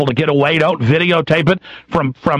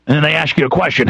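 ordinary talk, nothing marked

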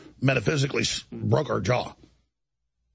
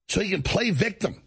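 ordinary delivery

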